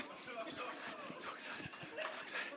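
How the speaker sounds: excited whisper under breath. Real, not acted. Excited whisper during auction.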